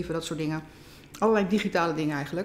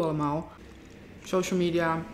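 talking while chewing; words muffled by food; mouth full. Real, not acted. Seen 1: Takes forkful at 3.1, chews and talks through 4.3.

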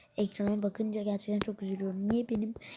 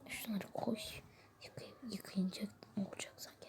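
very soft, half-whispered complaint; muttered grumble; 12 dB quieter than usual. Acted. Waiter complaining about washing up, muttered grumble. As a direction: grumble it under your breath.

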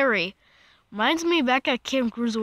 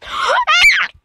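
sudden high startled gasp; a real gasp of fright, sharp and loud; 13 dB louder than usual. Real, not acted. Player caught off guard in the dark, sharp loud gasp.

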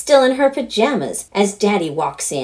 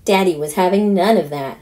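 ordinary delivery, nothing marked

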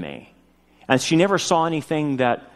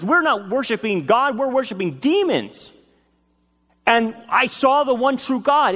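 high, urgent preaching of a revelation; impassioned retold testimony; 9 semitones higher than usual. Former occultist declares Jesus is God, fervent retold testimony. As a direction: act out a convert's fervent realization, pitched well up.

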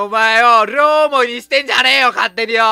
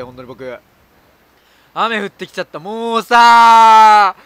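normal talking voice

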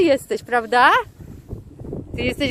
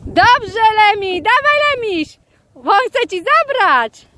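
high, excited cheering on; squealing, shouting; 7 semitones higher than usual. Real, not acted. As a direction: urge the dog on in a real excited squeal. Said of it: "Jejku, ja znowu krzyczę". (podekscytowany, piskliwy okrzyk do psa)

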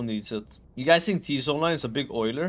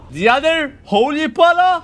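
loud, very high disbelieving question; incredulous; 12 semitones higher, 8 dB louder than usual. Real, not acted. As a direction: ask it in shrieking disbelief. Incredulous at another healer.

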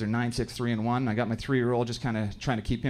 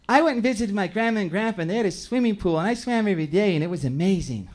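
put-on higher, sweet child voice; girl telling the class about summer; little girl; 5 semitones higher than usual. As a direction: chirp it like a happy kindergartner.

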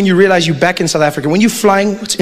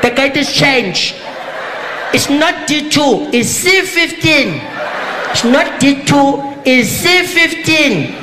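South African announcer; 3 semitones higher than usual. Retold or imitated. Comic playing a careless Johannesburg airport announcer talking to passengers. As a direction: ramble the announcement like you forgot the mic is on. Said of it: "she'll come and be like".

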